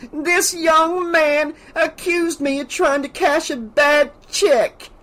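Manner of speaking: slow, high-pitched theatrical accusation; hammy outrage. A narrator's reading. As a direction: play the wronged victim, laying it on thick. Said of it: "her acting very hammy".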